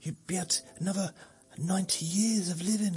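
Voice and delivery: mock tempting offer.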